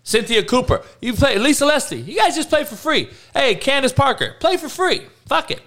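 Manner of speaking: high mocking voice, dismissive; mock boss voice. Mocking the league telling stars to play for free. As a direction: lift the voice up high and sneer out the orders.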